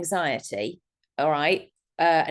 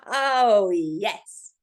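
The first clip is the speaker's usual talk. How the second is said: long drawn-out, delighted "oh, yes"; imitating your own thrilled reaction; excited realisation. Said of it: "It was so exciting".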